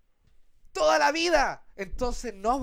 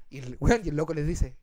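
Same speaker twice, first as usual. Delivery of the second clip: normal talking voice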